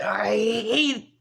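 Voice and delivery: fighting back tears mid-sentence; voice breaking. Real, not acted. Vlogger admitting to viewers he can't afford data, crying. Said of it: "I'm sorry for my voice".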